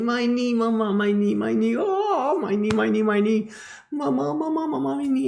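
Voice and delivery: put-on child sobbing my knee; whining child. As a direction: wail like a hurt little boy. Child crying about his knee.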